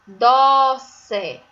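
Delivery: "Doce" as a loud, long "DOO" then "ce"; two full beats on "Doce"; syllable by syllable. Shown as a demonstration. Teacher naming the drawing for pupils, "Doce" in stretched syllables. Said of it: "Inicia com a sílaba".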